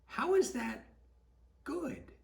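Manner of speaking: high wondering question. Real, not acted. Rhetorical question to children.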